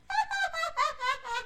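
shrill mocking cackle from a girl; high cackling laugh. Rose laughing at the magic axes, high cackle. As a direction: laugh high and nasty at the sight.

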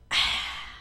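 breathy "ahh" after a drink; satisfied sigh. A narrator's reading. Old woman finishes her water, satisfied sigh. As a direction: let out a long refreshed sigh.

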